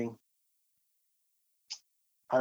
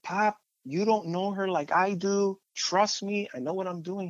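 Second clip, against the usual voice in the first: higher, slow, insisting to dad; defiant insistence; 7 semitones higher than usual. Retold or imitated. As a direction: plead your case, pitched up and slow. Son pushing back at dad.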